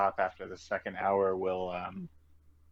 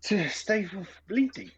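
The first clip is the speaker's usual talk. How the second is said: higher and slow, worn-out voice; exhausted character; 11 semitones higher than usual. Acted. Traveler explaining the nose grease to companions, exhausted. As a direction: explain it wearily, drawing the words out. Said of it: "exhausted, rather".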